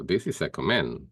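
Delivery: ordinary talk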